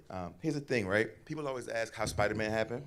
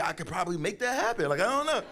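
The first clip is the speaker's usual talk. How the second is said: playful shrug; 11 semitones higher, 5 dB louder than usual. Retold or imitated. His amused answer.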